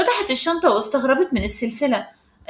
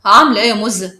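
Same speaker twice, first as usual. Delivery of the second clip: louder, lower sly come-on; mildly sly teasing, pitched down; 4 semitones lower, 7 dB louder, faster than usual. A narrator's reading. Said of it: "خالد بخبث".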